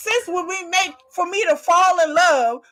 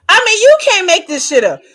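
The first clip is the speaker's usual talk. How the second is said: loud exclamation; 3 semitones higher, 10 dB louder than usual. Real, not acted. Telling viewers this is unbelievable, loud exclaim. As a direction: shout it out loud.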